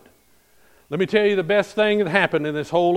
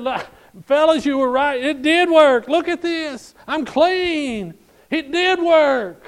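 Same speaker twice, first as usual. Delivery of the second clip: much higher, loud, overjoyed cry; excited exclamation; 10 semitones higher, 4 dB louder, slower than usual. Acted. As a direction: shout the good news to his men. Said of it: "He is excited".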